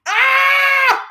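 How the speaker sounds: a loud, high excited yelp. Real, not acted. Streamer squeals loudly at her chat.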